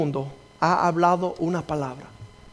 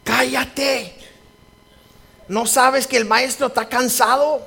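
rude disciples; 8 semitones higher, 4 dB louder, slower than usual. Retold or imitated. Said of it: "lo que estaban diciendo los discípulos".

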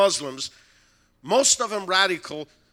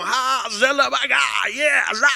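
loud, higher ecstatic prayer cry; loud tongues prayer; 6 semitones higher, 8 dB louder than usual. Retold or imitated. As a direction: call out in tongues. Praying in tongues in room.

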